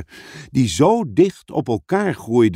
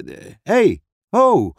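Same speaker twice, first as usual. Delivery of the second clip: higher, sudden interjections; startled cry; 9 semitones higher, 3 dB louder, slower than usual. Acted. Edmond cut off mid-question by an invisible tug, startled. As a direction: break off with a startled hey.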